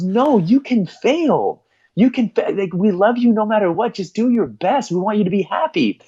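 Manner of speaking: high, emotional retold father's plea; tearful reassurance. Dad hugging his son, imitated tearful reassurance. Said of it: "And the dad starts crying".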